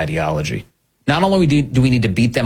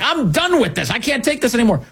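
higher, fed-up outburst imitating outraged people; mock exasperation; 12 semitones higher than usual. Host mocking how outraged viewers react, exasperated. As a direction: throw up your hands, done with it all. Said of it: "people are like".

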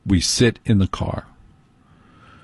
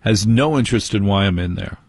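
usual speaking voice

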